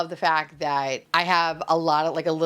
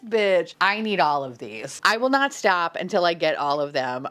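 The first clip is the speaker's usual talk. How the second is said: usual speaking voice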